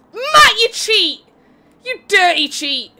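loud, drawn-out accusation of cheating; mock outraged shout. Commentator accuses racer Matt of cheating, mock outraged shout. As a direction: call out the cheat in playful outrage.

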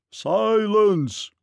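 great voice, high and drawn out; big booming command, stretched long; giant's roar. A narrator's reading. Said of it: "roared a great voice".

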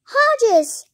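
high, loud call down the phone; excited shout. A narrator's reading. Dodsworth phoning Hodges with news, high shout. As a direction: shout the name. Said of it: "shouted Dodsworth".

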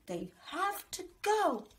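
a stepmother overruling the father; stepmother's voice. A narrator's reading. Stepmother to father, insisting. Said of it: "Stepmother said".

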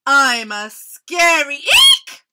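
loud, drawn-out storybook squeal; squealing tiger. A narrator's reading. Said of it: "the tiger squealed".

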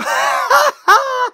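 mocking burst of laughter; high shrieking laugh. Real, not acted. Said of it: "Laughing at the fucking Giants right now".

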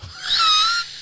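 high squealing laugh burst; shrieking laugh. Real, not acted.

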